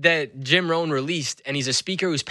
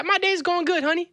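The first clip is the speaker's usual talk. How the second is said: very high-pitched imitation of a kitchen lady; kitchen lady's voice. Kitchen lady answering the customer, imitated in high voice. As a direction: put on her cheery reply, pitched way up. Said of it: "And she said".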